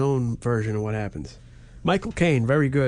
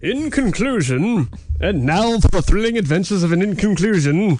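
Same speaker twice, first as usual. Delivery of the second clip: mock announcer; 5 semitones higher, 6 dB louder than usual. Hyping the show's conclusion, hammed-up booming announcer. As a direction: proclaim it loudly like an adventure serial intro.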